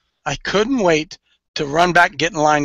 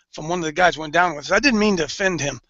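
ordinary talk, nothing marked

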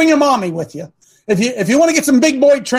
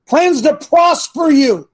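shouted scripture; 6 semitones higher, slower than usual. Acted. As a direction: drag out plans to prosper you.

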